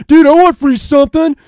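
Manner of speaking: high, loud dopey fan voice; mock a clueless dude begging; imitating a clueless MySpace fan; dumb fan. Mimicking MySpace fans.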